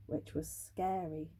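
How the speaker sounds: whispered, building suspense; hushed whisper. Acted. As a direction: drop to a spooky whisper. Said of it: "hushed suspense for children".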